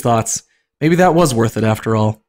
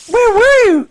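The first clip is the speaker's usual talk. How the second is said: high falsetto, relieved and fretful; squeaky relieved voice; worried big sister; 4 dB louder, slower than usual. Acted. Elsa scolding lost Anna in a high, worried falsetto. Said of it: "she repeated".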